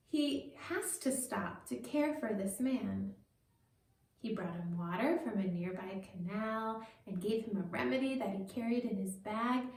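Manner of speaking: ordinary delivery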